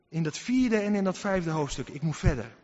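normal talking voice

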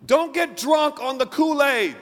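rally the congregation; fervent pulpit shout; preaching at full force. Acted. Preaching warning.

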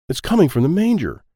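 usual speaking voice

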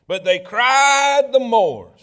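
CRIED stretched long and high; drawn-out CRIED. A narrator's reading. Preacher reading scripture to congregation, stressing CRIED long and high.

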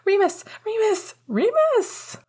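put-on very high, rising excited kid calls; excited child shouting. A narrator's reading. Little brother calls Remus over FaceTime, excited high shouting. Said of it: "shouting into the speakers".

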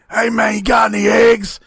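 put-on shouty voice, unlike his own; [name]. Friend mimicking the wrestler at the dealer's window, shouty put-on voice. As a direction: imitate the wrestler calling out a drug order. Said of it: "[name], it's just your voice".

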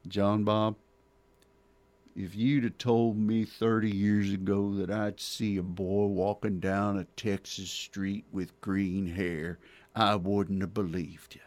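Texan brogue. Retold or imitated. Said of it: "just talking in Texan brogue".

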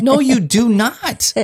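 high, slow, emphatic no you do not; telling the booth lady she is not from California; incredulous. Retold or imitated. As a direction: pitch way up and stretch it out in mock disbelief. Disbelieving retort to the booth lady.

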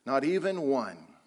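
ordinary talk, nothing marked

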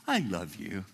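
low, gentle reply; tender assurance. Acted. God answering a preacher's failures, tender assurance. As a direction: drop low and reassure.

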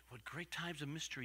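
ordinary delivery

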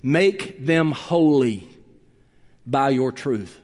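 slow, hushed quoted prayer; gentle prayer. Retold or imitated. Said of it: "Jesus prayed this prayer for us".

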